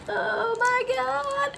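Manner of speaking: very high, slow, drawn-out squeak; shocked. Retold or imitated. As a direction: re-enact stunned shock in a tiny high voice. Acts reaction to cancer news.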